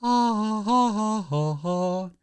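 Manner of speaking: high, evenly spaced mock laugh; put-on laugh. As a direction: sing out a fake ha-ha, sinking gradually. Performed laugh between silly chants.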